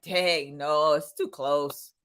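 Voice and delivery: ordinary talk, nothing marked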